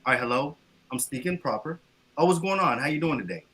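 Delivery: casual low-pitched phone hello; lightly acted answer to a personal call; easy, low everyday phone voice; personal phone greeting. Shown as a demonstration. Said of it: "let's play this. If, if you call me".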